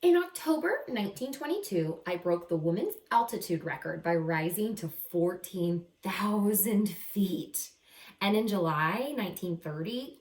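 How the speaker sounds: bright, lively picture-book reading voice; animated first-person story narration; reading to children. A narrator's reading. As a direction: read it like a storybook to young kids.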